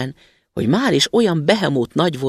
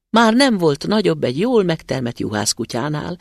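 ordinary delivery, nothing marked

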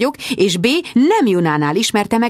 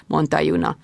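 plain reading voice

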